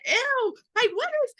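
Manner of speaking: high mock-disgusted ew. Brushing off a negative old acquaintance, mock disgust. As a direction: recoil like something smells bad. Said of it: "And I was like".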